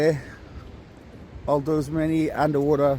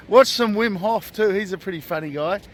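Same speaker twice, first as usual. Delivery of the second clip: usual speaking voice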